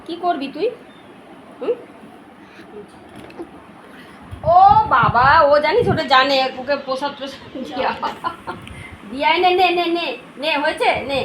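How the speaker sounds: high coaxing pet voice; talking to the dog. Real, not acted. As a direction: coax the dog to take it. Offering prasad to dog.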